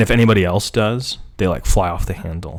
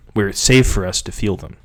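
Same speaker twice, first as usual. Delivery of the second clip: usual speaking voice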